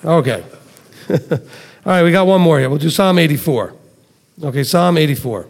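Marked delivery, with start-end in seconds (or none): none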